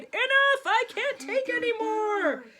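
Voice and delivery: loud, high outburst; king crying out. A narrator's reading. Exhausted king shouting at the dancers. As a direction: throw up your hands and shout it. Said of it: "the king cried".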